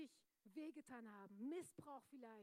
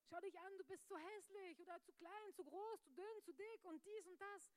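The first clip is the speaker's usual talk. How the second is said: higher, sneering taunt; mocking inner voice; 6 semitones higher than usual. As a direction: list the insults like a scornful bully. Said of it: "Diese ganzen Flüstern im Ohr". (höhere, höhnisch spottende Stimme)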